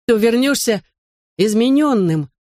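put-on high, strained childish warning; choked-up child. A narrator's reading. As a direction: force the words out to dad. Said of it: "он с трудом выдавливал из себя слова".

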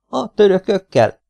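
put-on amused child's high-pitched disbelief; snorting amusement. A narrator's reading. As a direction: echo it high, as if about to snort with laughter. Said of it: "röhintette el magát Domi".

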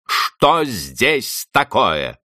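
high, slow startled cry; exclaiming. A narrator's reading. Housekeeper running in from kitchen, alarmed exclaim. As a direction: burst in demanding what happened. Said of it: "восклицая".

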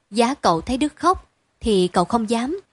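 ordinary delivery, nothing marked

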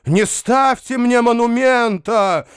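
high-pitched, drawn-out theatrical cry. A narrator's reading. Foma refuses a monument, crying out. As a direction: wail the refusal, stretching it. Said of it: "кричал Фома".

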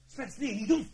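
loud, high cry to stop him; shouted plea. Acted. To Spence holding a gun, loud high plea. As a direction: yell at him to stop before he shoots. Stopping a man from shooting.

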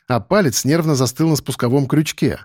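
ordinary delivery

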